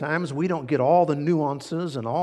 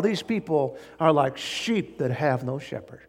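ordinary talk, nothing marked

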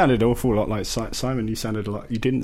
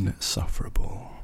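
softer, breathy whisper; whispered reveal; 5 dB quieter than usual. Acted. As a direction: whisper it as a punchline. Naming the show as a punchline.

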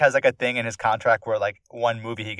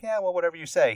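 high-pitched mock brush-off; dismissive retort; 9 semitones higher, faster than usual. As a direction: act out a dismissive "whatever". Retold argument, brushing him off.

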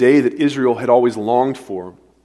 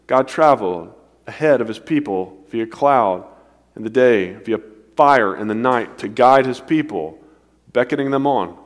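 ordinary delivery

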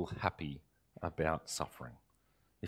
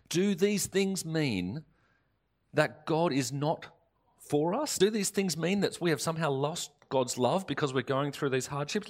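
higher, louder preaching build; impassioned preaching; 6 semitones higher, 3 dB louder than usual. Acted. Preacher rallying the congregation against despair, impassioned and rising. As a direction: preach it out with conviction.

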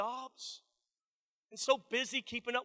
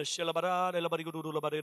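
babbling made-up tongues, lower and quick at the end; mock speaking in tongues; 6 semitones lower, faster than usual. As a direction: rattle off nonsense syllables like praying in tongues. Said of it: "I'm making up tongues".

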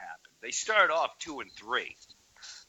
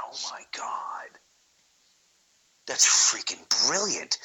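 awed whisper; 3 semitones lower than usual. Retold or imitated. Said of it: "I went".

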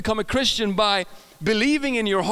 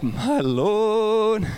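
long drawn-out mock swoon; hammed-up awe; 3 dB louder than usual. Seeing a beautiful girl at church, hammed-up awe. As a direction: stretch it out as if smitten.

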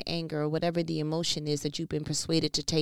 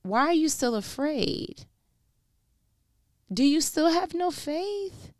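God asking, heightened; 11 semitones higher than usual. Acted. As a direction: stretch the words and let them soar. Same question, climactic take.

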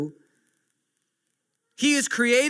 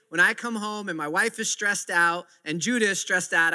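normal talking voice

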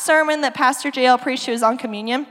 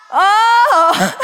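laughing realization; 6 semitones higher, 5 dB louder than usual. Real, not acted. Realizing the joke mid-retelling.

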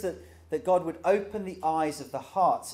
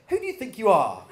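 higher, louder snotty retort with a laugh; uppity daughter; 8 semitones higher, 8 dB louder, faster than usual. Retold or imitated. Dad imitating his daughter's uppity comeback. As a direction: fire it back like a cheeky preteen. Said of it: "a sort of uppity moment".

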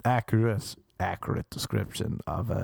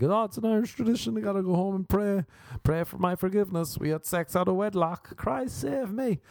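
high-pitched, hurried-out-the-door excuse; mock pious excuse; 10 semitones higher than usual. Acted excuse while fleeing after sex.

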